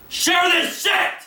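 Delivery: very high, very loud, slow drawn-out scream; all-out scream. Real, not acted. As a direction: scream the plea at the audience. Screams at listeners to share.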